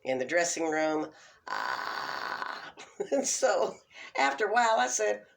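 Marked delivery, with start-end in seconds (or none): 1.5-2.7 deep crackling fry on ah; vocal fry. Retold or imitated. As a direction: let the ah crackle low and loose. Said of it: "Maxine pretty much did".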